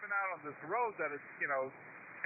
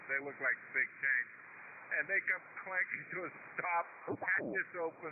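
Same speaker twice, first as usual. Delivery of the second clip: ordinary talk, nothing marked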